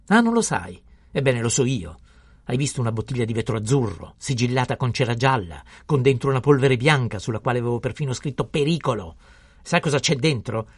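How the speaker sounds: loud, high, indignant scolding; angry tirade. A narrator's reading. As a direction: hammer the boy with accusations. Pharmacist rages on at the boy. (ramanzina furibonda ad alta voce)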